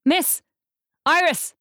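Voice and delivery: high, loud call across the street. A narrator's reading. Man calling after a woman who walks past. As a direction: call her name to stop her. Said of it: "He calls out".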